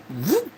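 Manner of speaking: quick high "whoop" sound effect; imitated swoosh. Telling how a man swiped the blankets, quick swooping noise. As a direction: zip upward like something whisked away.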